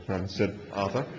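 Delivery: ordinary delivery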